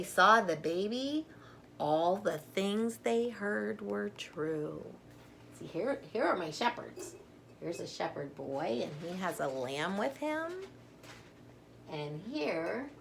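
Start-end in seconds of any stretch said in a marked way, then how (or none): none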